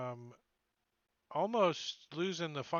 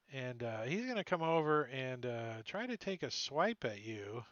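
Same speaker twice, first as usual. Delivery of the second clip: ordinary delivery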